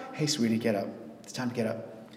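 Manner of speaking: gentle, homely, caring murmur; tender everyday voice. Retold or imitated. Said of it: "You know how this sounds?".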